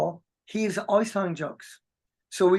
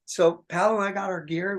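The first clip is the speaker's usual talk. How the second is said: ordinary talk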